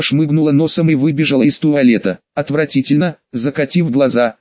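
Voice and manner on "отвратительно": ordinary delivery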